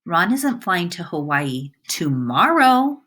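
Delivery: tomorrow set apart and punched loud; stress on tomorrow. Shown as a demonstration. Teacher reading example sentences, stress on tomorrow. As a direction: save the weight for tomorrow. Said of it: "depending on which word is stressed".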